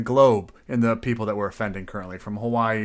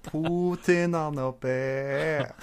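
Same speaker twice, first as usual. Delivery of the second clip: higher, very drawn-out Russian accent; 4 semitones higher, much slower than usual. Shown as a demonstration. Repeating the meme to tease a co-host, Russian accent. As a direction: stretch it out in a hammy Russian accent. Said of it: "I instantly was in a Russian accent".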